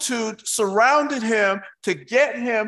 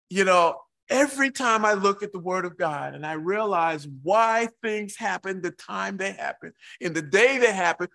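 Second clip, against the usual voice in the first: ordinary talk, nothing marked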